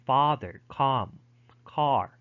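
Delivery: American "father", "calm", "car" with open ah; American pronunciation. Shown as a demonstration. Teacher listing words with the American ah, American vowel demonstrated. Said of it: "pronounced either with a".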